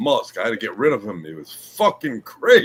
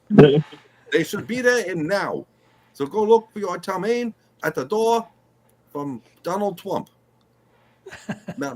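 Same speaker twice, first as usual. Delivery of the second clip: a hint of a Chinese accent, pitched up, playing a president; lightly put-on Chinese accent, hammed-up; mock Chinese accent; 6 semitones higher than usual. Doing Trump for the host in a mild mock Chinese accent. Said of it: "You wanted a Chinese accent".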